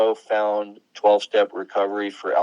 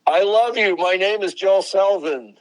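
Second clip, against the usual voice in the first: loud, high-pitched mock announcement; quoted exclamation; 9 semitones higher, 7 dB louder than usual. Guest quoting a journalist's obituary, loud and high. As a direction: belt out the quote, pitched well up. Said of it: "is he says".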